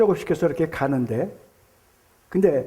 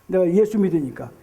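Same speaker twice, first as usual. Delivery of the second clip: normal talking voice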